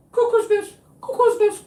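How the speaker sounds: very high sing-song "Cuckoo's nest" with the voice far from his own; high mocking falsetto chant. Mocking the hearing as crazy.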